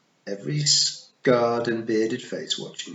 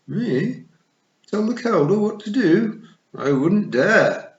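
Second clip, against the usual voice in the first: higher, louder, slowing toad; toad aghast; 12 semitones higher, 8 dB louder, slower than usual. A narrator's reading. The toad refusing to advise the Kelda, aghast. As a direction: toad recoiling at the very idea.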